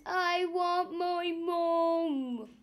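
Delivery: slow, high whimpering plea; slow high mock wail; babyish wail. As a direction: wail it like a scared child. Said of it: "he screamed".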